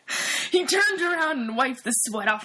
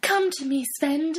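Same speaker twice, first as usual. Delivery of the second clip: high yearning woman voice; 5 semitones higher than usual. Acted. Brenda yearns.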